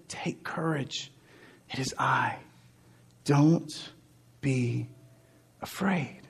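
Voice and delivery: slow, soft whispered reassurance; tender whisper. Acted. Jesus reassuring disciples instead of scolding, tender whisper. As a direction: hush their fear gently. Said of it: "He looked at them and he said".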